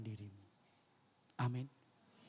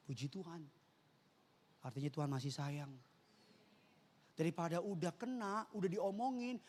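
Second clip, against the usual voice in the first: normal talking voice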